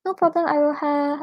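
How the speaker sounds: ordinary talk, nothing marked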